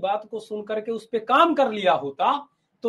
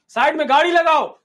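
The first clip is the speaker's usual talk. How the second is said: louder, higher command to pull over; loud order; 3 semitones higher, 8 dB louder than usual. Acted. Baba orders his disciples to pull over, loudly. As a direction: bark it as a clear order. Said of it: "बाबा ने कहा".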